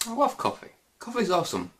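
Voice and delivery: high-pitched self-pep talk; forced enthusiasm. Real, not acted. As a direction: talk yourself into loving it. Hyping himself up about coffee.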